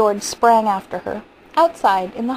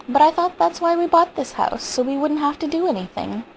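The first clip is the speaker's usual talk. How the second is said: higher-pitched, baffled objection; puzzled protest; 7 semitones higher than usual. Acted. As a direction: protest in bewilderment, voice raised in pitch. Husband protests wife's wish.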